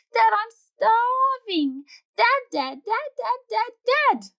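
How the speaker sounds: high, loud, slowing pestering whine building; nagging whine. Acted. Kids nagging Dad at the pool, rising loud whine. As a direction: pester him until he snaps.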